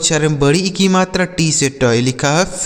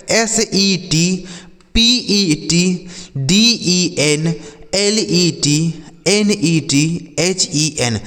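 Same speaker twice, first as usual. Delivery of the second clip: slow spelling; much slower than usual. Shown as a demonstration. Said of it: "एस ई टी, (teacher spells letter by letter)".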